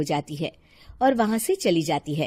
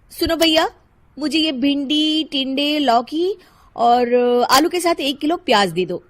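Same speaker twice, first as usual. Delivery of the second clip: lightly voiced housewife shopping; Shilpa ordering; 6 semitones higher, 7 dB louder than usual. Housewife listing vegetables to the seller, businesslike. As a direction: call out a brisk order to the vendor.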